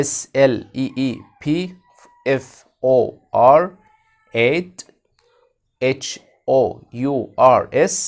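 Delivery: each letter said slowly and separately; slow spelling. Shown as a demonstration. Teacher spelling a phrase for kids, slow letters. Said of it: "spelling letters".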